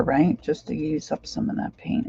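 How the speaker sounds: usual speaking voice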